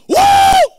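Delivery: very high loud whoop; mimicking a shouting church member; woo shout. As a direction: let out a worshipper's whoop. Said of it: "Person shout".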